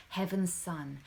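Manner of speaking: ordinary delivery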